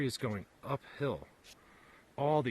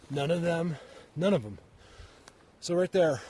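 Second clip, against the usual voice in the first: louder, higher, heated insistence; getting mad; 6 semitones higher, 6 dB louder than usual. Real, not acted. Arguing with doubters about fallen trees, loud and heated. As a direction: push hard, you are fed up. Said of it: "Sorry, I get mad".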